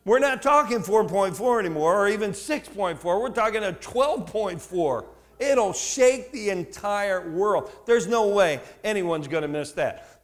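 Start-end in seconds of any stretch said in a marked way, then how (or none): none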